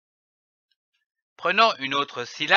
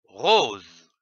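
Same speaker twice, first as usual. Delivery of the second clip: lecture grave; 8 semitones lower than usual. Shown as a demonstration. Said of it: "essayons de comprendre les mots".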